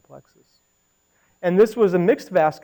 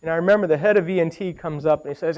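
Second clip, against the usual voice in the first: ordinary talk, nothing marked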